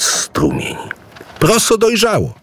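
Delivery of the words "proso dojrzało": high, excited call. Acted. Idu sees the ripe millet field and calls out, high and excited. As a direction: cry out in joy that the millet is ripe. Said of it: "zawołał".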